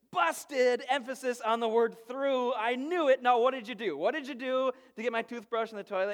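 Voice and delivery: loud, high, gotcha interrogation; imitating a sister who caught him out; triumphant pounce. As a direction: seize on the slip and press hard. Sister pounces on brother's slip.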